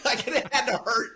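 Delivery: ordinary talk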